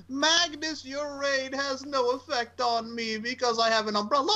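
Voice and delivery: high, slow character voice; Willow voice. Retold or imitated. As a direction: play it slow and lofty. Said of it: "this son of a gun said".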